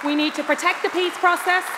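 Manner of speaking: usual speaking voice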